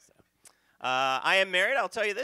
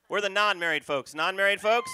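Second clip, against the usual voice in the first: calling out; 5 semitones higher, 7 dB louder than usual. Real, not acted. Comic polls the crowd.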